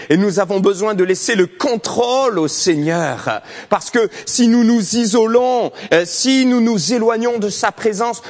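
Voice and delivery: full-force pulpit preaching; fiery preaching. Acted. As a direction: preach it out to the church.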